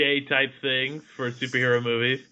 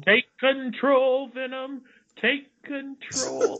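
much higher, slow cartoon plea; mock cartoon cry; 11 semitones higher, slower than usual. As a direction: stretch it out high like a cartoon hero.

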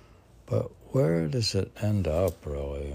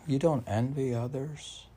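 ordinary delivery

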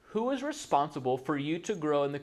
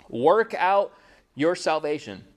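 "Work out" punched louder; stress on work out; 4 dB louder, slower than usual. Shown as a demonstration. Preacher stressing "Work out" to the congregation. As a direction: lean hard on "Work out" like a command. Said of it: "I'll emphasize what Paul is saying".

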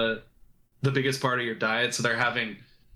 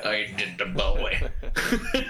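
slow, chest-puffed gruff voice; gruff hunter voice; 4 semitones lower, much slower than usual. Shown as a demonstration. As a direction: tuck the chin and rumble it. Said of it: "that voice right there".

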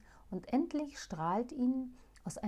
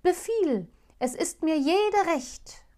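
loud, high, slowing at the end; loud high eager vow; eager shout; 8 semitones higher, 7 dB louder, slower than usual. Acted. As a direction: swear to anything, urgently. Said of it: "schrie Chasid".

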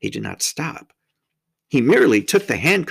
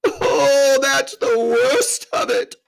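loud, slow, high wailing groan; tearful drawn-out groan; 6 dB louder, much slower than usual. A narrator's reading. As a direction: moan it out in misery. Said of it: "with a deep groan".

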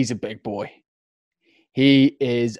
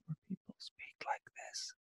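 ASMR whisper; 8 semitones higher, 25 dB quieter than usual. Shown as a demonstration. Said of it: "sounds like an A S M R podcast".

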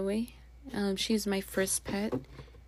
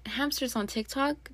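usual speaking voice

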